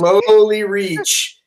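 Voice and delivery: drawn-out slowly. Acted. Player narrating a careful reach into the pouch, "slowly" drawn out. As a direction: draw out the word "slowly".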